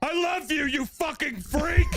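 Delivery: high, slow hammed-up yell; husband yelling affection at his weird wife, mock shout. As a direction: bellow it through the door as a joke. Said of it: "I shouted that I loved her".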